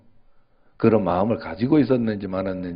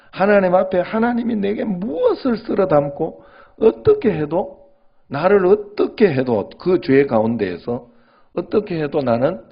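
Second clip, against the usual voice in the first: much higher, pleading preacher's cry; impassioned preaching; 8 semitones higher than usual. Acted. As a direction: lift the confession to a fervent peak. Preaching rises to a peak.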